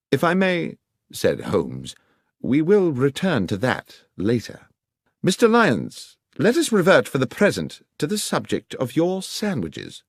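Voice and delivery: a lightly voiced, assured Holmes; Holmes giving orders. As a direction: instruct the inspector like a confident Holmes. Holmes questioning the guard.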